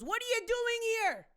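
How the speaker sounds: high, loud challenging yell; confrontational shout. Retold or imitated. Man confronting ice agents with his phone, loud high challenge. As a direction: get right in their face demanding answers. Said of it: "and he goes".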